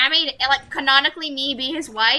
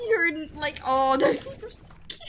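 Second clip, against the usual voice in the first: normal talking voice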